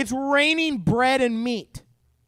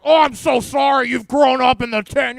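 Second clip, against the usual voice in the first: louder, over-the-top fake pity; mock sympathy; 3 semitones higher, 7 dB louder than usual. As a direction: gush sarcastic sorrow like a teasing parent. Parents mocking complaining kid.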